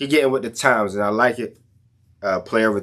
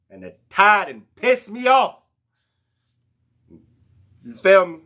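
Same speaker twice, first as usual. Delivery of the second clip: louder and higher, fired up; pitched-up angry rant; heated rant; 8 semitones higher, 4 dB louder than usual. Real, not acted. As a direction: vent the frustration hard. Ranting about Bama.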